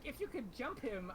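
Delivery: usual speaking voice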